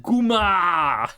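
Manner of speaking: drawn-out Sopranos-style Italian-American exclamation; Italian-American outburst. Retold or imitated. Streamer recalling a bite of food after Sopranos clips, Italian-American exclamation. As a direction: burst out like an Italian-American mobster, stretching the last vowel. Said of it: "watching so many fucking Sopranos clips".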